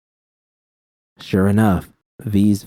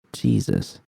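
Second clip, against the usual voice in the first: breathy whispered Jesus, much softer; muttered; 13 semitones lower, 6 dB quieter than usual. A narrator's reading. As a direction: let it slip out under your breath. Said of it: "I muttered".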